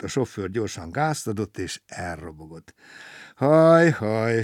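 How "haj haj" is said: long, loud "haj", then a falling echo; sighing. A narrator's reading. Stranded traveller bemoans his bad luck, sighing. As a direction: heave a theatrical storybook sigh. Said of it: "sóhajtozott Gombóc Artúr".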